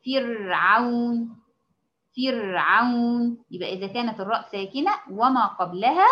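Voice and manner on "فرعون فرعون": فرعون drawn out very slowly, the ra after kasra kept thin; slow stretched فرعون; tajweed demo word. Shown as a demonstration. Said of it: "زي مثلًا كلمة إيه".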